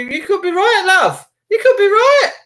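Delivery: high, loud, rising exclamation; high-pitched, emphatic agreement; exclaiming agreement. Real, not acted. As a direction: pitch it way up and call it out.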